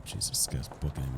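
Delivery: tense hushed whisper. Real, not acted. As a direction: whisper so the enemy can't hear. Hiding from a fast enemy.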